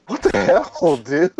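high, laughing what-the-hell; mock outrage breaking into a laugh; retelling his stunned reaction with a chuckle; laughing disbelief. Retold shock at rudeness.

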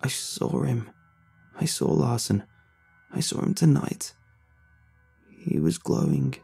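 soft, pallid and broken; a quiet, pitiful confession. A narrator's reading. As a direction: barely get the words out, fading. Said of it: "pallid and pitiful sounding".

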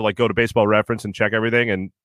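ordinary delivery

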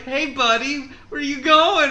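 mock friendly killer. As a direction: play a killer being too friendly. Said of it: "He's like".

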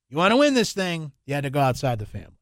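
normal talking voice

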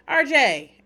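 loud, high exasperated name call. Real, not acted. Fed up with the kid's nitpicking, a loud exasperated call.

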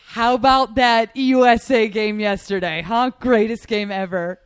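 loud, slow, gloating brag; loud boasting. Retold or imitated. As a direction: crow about it proudly. Boasting about USA game.

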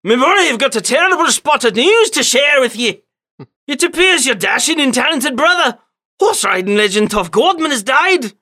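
boisterous British uncle, pitched high; loud, hammed-up British announcement; Uncle Penny Codsworth Manshire. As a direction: crow the news in a high British voice. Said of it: "my boisterous British uncle".

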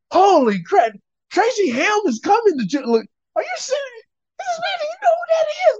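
very high, squealing imitation of amazement; mock starstruck excitement. Son mimicking dad and coach over Tracy Ham, starstruck squeal. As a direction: gush in an exaggerated high falsetto.

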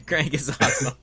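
laughing echo. Real, not acted. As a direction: repeat it giggling.

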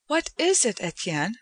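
a worried wife-to-be asking gently; Joanna's concerned voice. A narrator's reading. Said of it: "she said".

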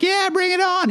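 high, lightly mocked eager cheer; eager group reply. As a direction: imitate keen followers egging him on. Voices the eager group answering him.

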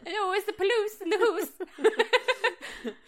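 high, laughing panicked caller; mock panicked emergency call. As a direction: squeal it like a frantic caller. Mocking the 911 call.

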